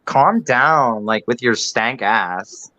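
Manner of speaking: lightly voiced Valley girl accent; a hint of Valley girl attitude. Shown as a demonstration. Doing a Valley girl accent to tease Lauren. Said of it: "I'm doing a Valley girl accent".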